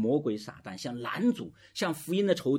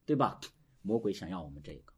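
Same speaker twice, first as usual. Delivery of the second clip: ordinary talk, nothing marked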